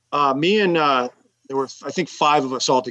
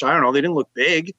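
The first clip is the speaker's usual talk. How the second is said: ordinary delivery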